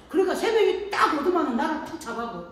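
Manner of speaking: ordinary delivery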